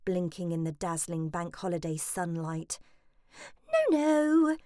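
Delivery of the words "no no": airily. Acted. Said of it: "she said airily".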